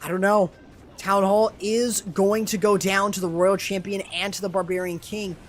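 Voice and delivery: normal talking voice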